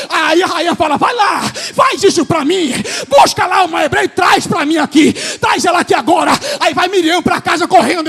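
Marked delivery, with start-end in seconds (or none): 1.0-6.3 loud, urgent royal command; commanding shout. Acted. Queen loudly orders Miriam to fetch a Hebrew nurse. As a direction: order her to go now.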